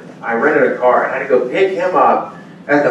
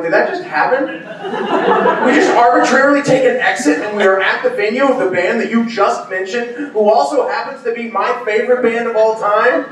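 laughing disbelief; 8 semitones higher than usual. Retold or imitated. Astonished at the lucky exit.

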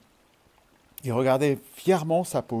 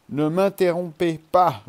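grumbling; 5 semitones higher, 5 dB louder than usual. A narrator's reading. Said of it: "grommela Humble".

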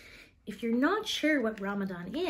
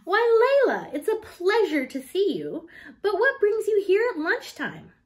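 much higher, louder welcoming voice; a librarian pleasantly surprised by a visitor; bright, louder surprised greeting; delighted librarian; 11 semitones higher, 8 dB louder than usual. A narrator's reading. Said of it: "asked Missus Carmen, the librarian".

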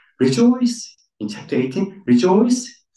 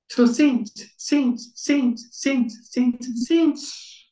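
saints repeated, pitch climbing higher each time; building repetition; 11 semitones higher, much slower than usual. Acted. Preacher drives home saints six times, rising. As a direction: hammer the word saints, rising to the top.

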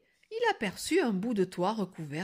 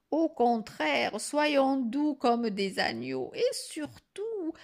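higher granny voice, slowing down; lightly voiced scheming grandmother; 6 semitones higher, slower than usual. Grandmother persuades the wolf to act nice, gently conspiratorial. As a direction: coax slowly towards a sly plan. Grandmother slyly proposes being sweet.

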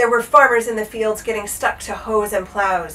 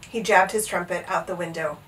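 plain reading voice

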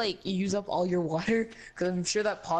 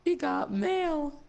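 higher, slow teasing echo; mock high echo; 10 semitones higher, much slower than usual. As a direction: stretch it out, teasing. Mocking echo of friend.

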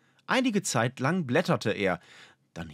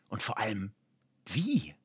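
softer, musing under breath; whispered to himself; 5 dB quieter than usual. A narrator's reading. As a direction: wonder aloud barely audibly. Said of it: "Flüsterte er vor sich hin".

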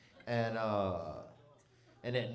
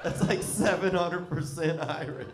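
real laughter breaking through, louder and slow; laughing through it; 8 semitones higher, 6 dB louder, slower than usual. Real, not acted. Comic to the crowd, cracking up at his own Irish joke, laughing and slow. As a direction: crack up while getting the words out.

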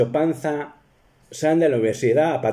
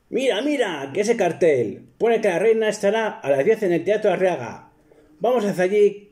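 much higher, excited squire voice; eager Sancho; 8 semitones higher than usual. Retold or imitated. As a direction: point it out eagerly. Sancho spots the poster. (Sancho entusiasmado, voz mucho más aguda)

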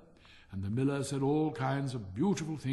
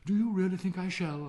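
higher, lightly voiced humble Hans; hopeful Hans; 7 semitones higher, 5 dB louder than usual. Hans asks the miller for encouragement, hopeful. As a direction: ask with meek hope.

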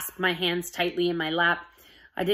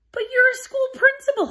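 breathless, much higher protest; high panicked whisper; 6 dB louder than usual. Acted. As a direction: gasp it out in disbelief.

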